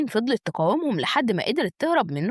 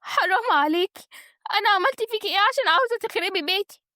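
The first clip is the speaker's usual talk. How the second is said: high, wailing reproach; crying hard; 11 semitones higher than usual. A narrator's reading. Naglaa accuses Wateen of wrecking her home, crying. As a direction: plead tearfully as the wronged one. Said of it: "دخلت بعياط شديد وقالت".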